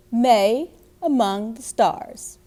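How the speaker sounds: ordinary delivery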